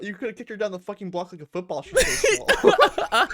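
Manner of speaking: laughing through the words. Real, not acted. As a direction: crack up while teasing. Laughing while mocking.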